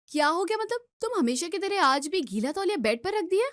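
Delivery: high-pitched, exasperated nagging; indignant scolding. Acted. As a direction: pounce on his question and scold him. Wife scolds husband over wet towel. (चिढ़कर ऊँची आवाज़ में डाँटना)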